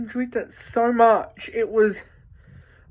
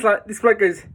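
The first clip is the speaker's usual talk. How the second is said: ordinary talk, nothing marked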